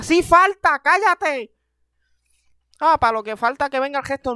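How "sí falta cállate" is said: loud high snap back; angry retort. Acted. Scolding someone who tempts fate, loud high retort. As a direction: shut them up hard.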